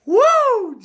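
high, loud joyful whoop; spontaneous cry of praise. Real, not acted. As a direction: let out a high happy whoop. Preacher fired up.

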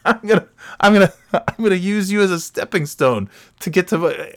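high-pitched, giddy boast; mock trash talk. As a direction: stumble into an absurd cocky threat. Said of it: "I'm shit-talking him back".

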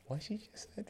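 high-pitched incredulous retelling; baffled disbelief. Retold or imitated. Husband retelling his reaction to his wife's word, baffled. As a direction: jump up high in puzzled, amused surprise.

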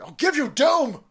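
slow, high, loud mock fury of a dad; angry dad. Dad yelling back at his son about Doom, mock roar. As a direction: bellow it back like a furious father. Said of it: "My dad's like".

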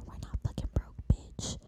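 voiceless, biting retort; fierce whisper. Retold or imitated. Telling the guy they're not broke in a fierce whisper. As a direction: whisper it with bite.

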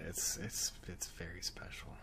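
very soft, near-whispered remark; hushed. Real, not acted. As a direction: say it almost under your breath. Hushed mock-reverence for the martini glass.

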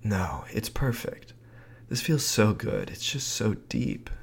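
soft, slow breathy near-whisper. Retold or imitated. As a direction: breathe the words out softly. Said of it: "in the cutest, breathy voice".

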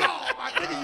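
high, laughing disbelief; half-laughing disbelief. Retold or imitated. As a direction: laugh at your friend's nerve. Laughing reply to friend.

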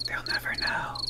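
conspiratorial whisper; secret whisper. Pretend. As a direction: whisper it so nobody hears. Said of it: "telling secrets".

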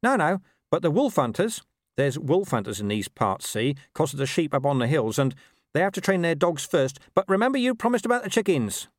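high-pitched dog passing on news; urgent talking dog. Acted. Gaspode explains, insists on chickens.

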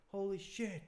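high and laughing with disbelief; amazed bystander. Retold or imitated. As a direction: gasp it out like an awed onlooker. Crowd gasps in Rick and Morty.

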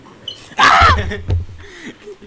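loud high laugh-scream; shrieking laugh. Real, not acted. Stabbed again, laugh shout.

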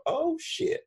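mock realization.

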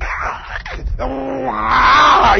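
high, drawn-out mock scream of pain; imitated tortured scream. Man on the stretcher in an old movie, mock screaming. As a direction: cry out as if being stretched.

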